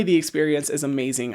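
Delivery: ordinary talk, nothing marked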